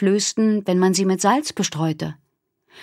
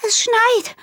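much higher, bright exclaiming; excited call. Acted. Minnie noticing the snow, excited call. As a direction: cry out in wonder. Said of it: "rief Minnie aus".

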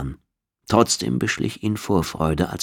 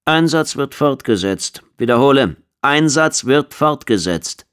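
radio confirmation; 7 semitones higher, 4 dB louder than usual. A narrator's reading. Command confirming the mission to shuttle, measured radio voice.

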